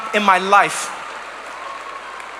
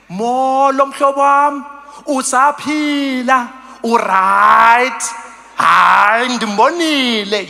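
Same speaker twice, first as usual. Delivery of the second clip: slow, long-vowelled Xhosa greeting; leisurely, full-voiced Xhosa; open-mouthed, unhurried Xhosa; 3 dB louder, much slower than usual. Shown as a demonstration. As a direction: take your time, stretch every vowel wide. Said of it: "We can take our time".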